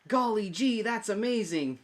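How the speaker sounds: put-on high, loud gee-whiz kid voice; wholesome 1950s kid. Shown as a demonstration. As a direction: gush like an old-timey wholesome kid. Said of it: "you can say".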